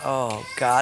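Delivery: low, subdued sigh of a prayer; convicted. Retold or imitated. Father realising he failed his son, convicted. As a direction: utter it with quiet remorse. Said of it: "I felt so convicted".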